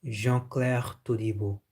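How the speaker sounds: French-style Jean-Claire Todibo; French pronunciation. Shown as a demonstration. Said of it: "That's how they say".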